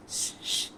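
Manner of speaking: soft unvoiced shh shh; quiet shushing sound. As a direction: hush the audience with a breathy shh. Character shushing the audience.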